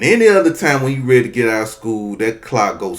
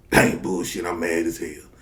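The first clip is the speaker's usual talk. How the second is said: normal talking voice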